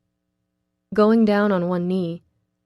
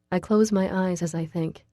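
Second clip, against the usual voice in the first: ordinary delivery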